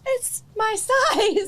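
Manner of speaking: high, drawn-out delighted squeal; thrilled amazement. Real, not acted. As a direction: marvel slowly, high with joy. Gift coat fits her.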